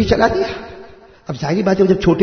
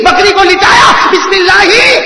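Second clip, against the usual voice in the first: excited; 9 semitones higher, 6 dB louder, slower than usual. Acted. Eager companion slaughters the goat.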